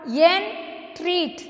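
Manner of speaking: "entreat" as en, treat with a pause; "entreat" divided in two for learners; syllable by syllable. Shown as a demonstration. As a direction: split "entreat" into two slow beats. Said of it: "entreat is divided into two syllable".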